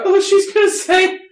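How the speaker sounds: put-on high, loud girly voice; man doing a woman's voice. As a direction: pitch way up like a woman. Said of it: "girl voice".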